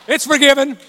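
high, loud instant pardon; eager forgiveness. Retold or imitated. Kids offered ice cream, forgiving excitedly.

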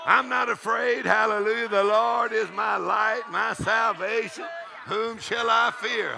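high, loud, slow preaching shout; triumphant proclamation. Acted. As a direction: proclaim it slowly at full voice. Preacher proclaims scripture against fear.

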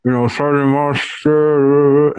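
slurred, slow caller with muffled words. Retold or imitated. Imitating an injured caller asking for the sergeant, muffled. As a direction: imitate a caller mumbling slowly through muffled speech. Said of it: "a really muffled voice".

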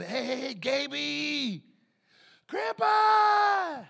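loud, high joyful shout across the mall; calling out. Retold or imitated. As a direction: yell the greeting arms wide. Grandpa arriving calling out.

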